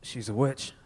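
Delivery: low, soft boozy pronouncement; deep, quiet mayor's verdict; drunk mayor. Acted. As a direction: drop it low like a tipsy official. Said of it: "he's always drunk".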